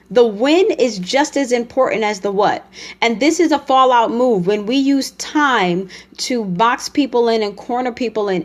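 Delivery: normal talking voice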